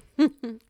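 sudden bright laugh; loud chuckle. Acted. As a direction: burst out chuckling. Amused pause in roleplay.

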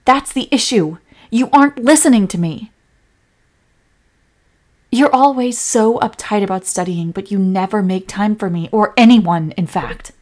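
loud, accusing quarrel; angry yelling. Acted. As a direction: have it out with a neglectful partner. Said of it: "You just yelled at them".

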